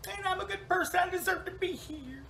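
hammed-up squeaky self-defence; high-pitched protest. Newcomer defends her place in heaven, hammed-up high protest. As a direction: plead your case way up high. Pleads her case to the hippie.

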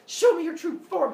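high, loud, insistent order; acted furious challenge; angry demand. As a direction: demand it at the top of your voice. Angry woman demands proof.

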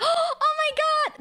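a startled squeal of delight; retold gasp of shock. Streamer replaying being recognized, high shocked squeal.

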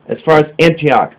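plain reading voice

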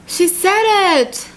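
high, drawn-out squeal of delight; high, stretched-out exclamation; thrilled reaction. Real, not acted. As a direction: gush it slowly, pitch way up. Thrilled reaction to story's declaration.

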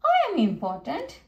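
sniffy pride from a pompous engine; haughty sniff. A narrator's reading. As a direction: proudly insist on your own importance. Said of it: "sniffed Gordon".

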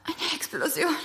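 faint, breathy, fading whisper; weak whisper. Acted. Sedated Martina telling the police, in a weak whisper. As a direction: barely get the words out.

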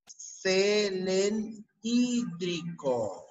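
very slow, drawn-out, broken into pieces; slow dictation. Shown as a demonstration. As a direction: stretch "selenhídrico" out as if for note-takers. Dictating new term in parts. (dictado muy lento, sílaba por sílaba)

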